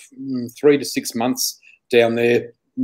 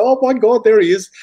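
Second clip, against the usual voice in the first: higher, louder retold exclamation; excited recognition; 10 semitones higher, 5 dB louder than usual. Woman recalls spotting him on the news, excited. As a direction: exclaim it on spotting a familiar face.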